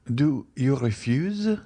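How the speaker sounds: slow, trembling plea, voice wavering; a wounded, faltering question; tremulous. A narrator's reading. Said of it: "in a tremulous voice".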